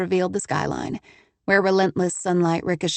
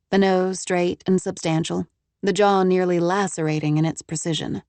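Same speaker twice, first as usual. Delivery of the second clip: ordinary delivery, nothing marked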